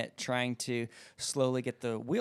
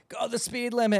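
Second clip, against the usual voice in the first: mildly mocking, higher-pitched heckle; annoyed driver; 9 semitones higher than usual. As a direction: lightly imitate an impatient honking driver. Drivers behind honking at him.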